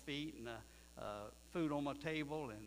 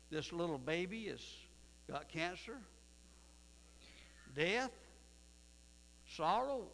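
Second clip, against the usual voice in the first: rising disbelieving pleas to the Lord; incredulous questions; 3 semitones higher than usual. Acted. Preacher pleading with God before the church, incredulous. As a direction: ask the Lord in pained disbelief, higher.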